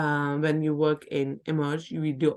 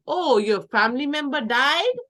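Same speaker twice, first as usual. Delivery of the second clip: blunt reaction; 7 semitones higher, 5 dB louder than usual. Shown as a demonstration. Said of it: "Somebody passes".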